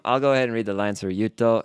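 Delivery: usual speaking voice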